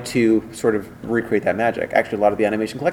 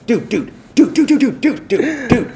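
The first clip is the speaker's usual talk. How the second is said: imitating a friend growing more and more excited; building dude; 11 semitones higher, slower than usual. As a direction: keep rising as the excitement builds. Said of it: "He's like".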